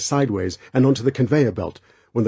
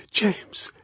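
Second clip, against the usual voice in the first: whispered; 11 semitones higher, 4 dB quieter than usual. Acted. Girl recognizing Bond.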